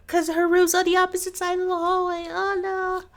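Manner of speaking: high, loud wail easing off at the end; distressed wail. Real, not acted. Player realizes Irma may die meanwhile, high distressed wail. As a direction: wail it with dawning dread. Player dreads character's death offscreen.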